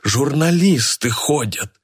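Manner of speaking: high, slow, anxious appeal; frightened pleading. A narrator's reading. Organiser fears journalists will hear, pleading in fright. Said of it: "испуганно воскликнул он".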